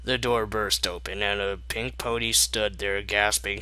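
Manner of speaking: usual speaking voice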